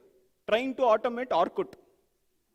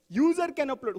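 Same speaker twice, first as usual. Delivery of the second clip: "User" stretched and higher; stress on USER; 4 semitones higher than usual. Shown as a demonstration. Teacher emphasizing the next word of the requirement, "User" stressed. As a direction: hit "User" high, then move on. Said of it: "let's do that for the".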